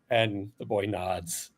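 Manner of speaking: ordinary delivery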